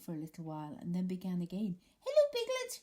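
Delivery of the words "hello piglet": very high, tiny excited squeak; squeaky Roo. Acted. Roo greeting Pooh at the party, high squeak. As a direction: squeak it out like a little joey. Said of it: "he squeaked".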